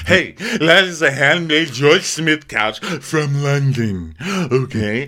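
slow, high, loud grandiose boast; hostess impression. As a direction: draw it out with mock affront. Voices the hostess defending her couch.

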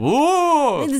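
high, loud spooky wail; spooky ooh. Pretend. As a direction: moan it like a ghost. Spooky voice tease.

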